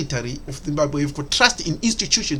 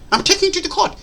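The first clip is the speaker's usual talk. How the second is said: mocking quote; 13 semitones higher, faster than usual. Mocking repeat of announcement.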